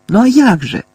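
high, loud exasperated question; impatient retort. A narrator's reading. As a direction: throw it back impatiently. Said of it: "нетерпляче відказала місіс Фіг".

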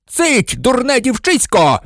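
high, drawn-out furious outburst; sudden angry yell, pitched high; crazed shout. A narrator's reading. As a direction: shout them down like a madman. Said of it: "закричав раптом Снейп, мов божевільний".

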